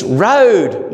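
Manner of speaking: put-on little boy's emphatic Australian "road"; Australian accent. Son correcting his mother's singing, stressed Australian "road". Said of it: "he won't let Ali sing it in a Scottish accent".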